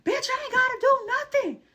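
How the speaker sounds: very high, loud put-on shriek; hammed-up defiant girlfriend voice.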